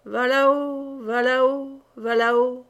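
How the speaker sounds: a hint of a creaking mill voice; talking mill. A narrator's reading. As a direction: let the mill drone its refrain. The mill speaks in the tale.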